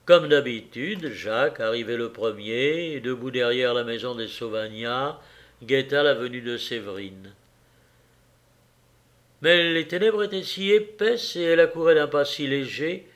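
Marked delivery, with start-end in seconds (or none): none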